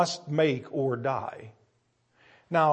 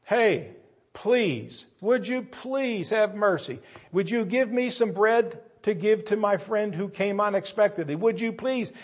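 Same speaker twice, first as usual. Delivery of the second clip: high, earnest begging at the window; pleading; 6 semitones higher than usual. Acted. Friend at midnight begging the man upstairs, high pleading voice. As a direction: beg for bread, voice lifted and urgent.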